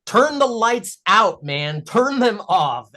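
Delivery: high, loud, drawn-out cartoon protest; Phil. Cartoonist voices his frog character's reaction to the lights, exasperated protest. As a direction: act out an exasperated, stretched-out plea. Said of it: "Phil's reaction is like".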